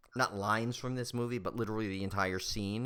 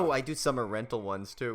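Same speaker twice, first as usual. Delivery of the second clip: ordinary delivery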